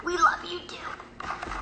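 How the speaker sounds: slow, drawn-out whisper; whispered affection. Real, not acted. Telling fans they are loved, slow whisper.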